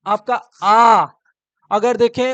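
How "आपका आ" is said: slow, louder open आ; back vowel demo. Shown as a demonstration. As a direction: draw out आ from the throat. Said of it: "जैसे बात करें". (कंठ से धीमा आ)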